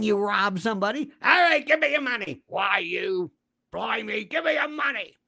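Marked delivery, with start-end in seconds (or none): 1.2-2.4 high-pitched attempt at a British accent; muddled British accent. Blind drunk robber demanding money, in a muddled British accent. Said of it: "I don't know what that accent is". 2.5-5.1 put-on muddled British accent. Blind drunk robber demanding money, in a muddled British accent. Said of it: "I don't know what that accent is".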